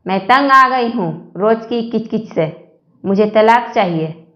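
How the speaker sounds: normal talking voice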